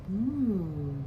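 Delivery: usual speaking voice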